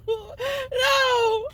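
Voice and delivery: high squealing laugh through words; laughing high protest. Retold or imitated. Retelling crying refusal to God.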